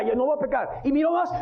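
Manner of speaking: ordinary talk, nothing marked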